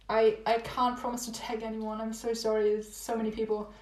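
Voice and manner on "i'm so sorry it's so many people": ordinary talk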